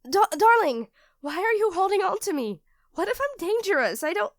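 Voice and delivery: high, loud sudden alarm. Acted. Alarmed that her partner is holding her, high and loud. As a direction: jolt up in fear for him.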